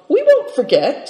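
high-pitched, taken aback reply; flabbergasted. A narrator's reading. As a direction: answer as if caught off guard. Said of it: "thoroughly flabbergasted".

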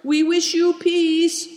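high, drawn-out call; crying out. A narrator's reading. Boy calling out a parting wish to those left behind, crying out. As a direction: call out a farewell, slowly and high. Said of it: "cried Big Tiger".

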